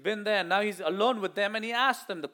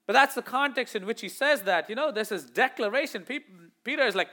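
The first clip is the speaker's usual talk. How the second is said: ordinary talk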